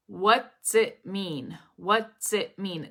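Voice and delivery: "What's it mean" slowly, as what / tsit / mean; slow word-by-word linking demo of "What's it mean"; T-S connection. Shown as a demonstration. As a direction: draw out "What's it mean" to show the tss. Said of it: "we have the tss".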